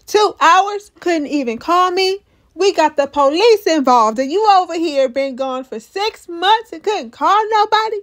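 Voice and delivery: high, loud, indignant scolding; mock outrage at a friend gone for months; upset friend. [name] scolding Christy for vanishing, indignant and loud. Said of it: "[name] is upset with Christy".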